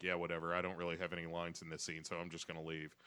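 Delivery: low, quiet, offhand mock Mountie; underplay a bored bit player, voice dropped; indifferent Mountie. Voicing the Mountie with no lines, low offhand mumble. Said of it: "the Mountie is like".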